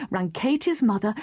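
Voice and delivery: ordinary delivery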